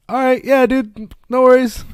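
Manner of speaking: high-pitched, slowed-down mock friend; friend brushing it off. Puzzled friend backing off from a game invite, mock brush-off. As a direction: put on a high, slow brush-off.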